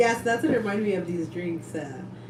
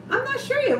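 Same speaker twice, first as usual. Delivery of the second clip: put-on much higher child's answer; kid voice. Children answering about Halloween costumes. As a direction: squeak it like a little kid. Voicing the kids' reply.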